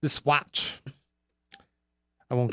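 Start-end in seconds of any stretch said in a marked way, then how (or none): 0.0-0.7 Christopher Walken impression. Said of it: "Hey, Christopher, is that your watch?".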